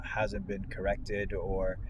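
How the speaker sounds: ordinary talk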